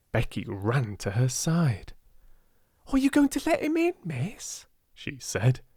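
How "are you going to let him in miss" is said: high, quick little maid; a young servant girl, eager; Becky's voice. Acted. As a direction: rush it out, wide-eyed.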